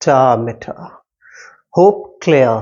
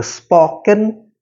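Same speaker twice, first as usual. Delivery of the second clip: normal talking voice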